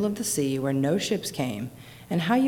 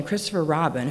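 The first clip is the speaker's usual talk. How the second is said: usual speaking voice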